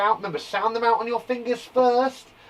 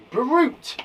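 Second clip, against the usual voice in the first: ordinary talk, nothing marked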